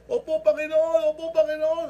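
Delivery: high, loud, pleading yeses; desperate eager shout. Retold or imitated. Hanging man answers God in an eager, desperate shout. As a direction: shout your yes up to God.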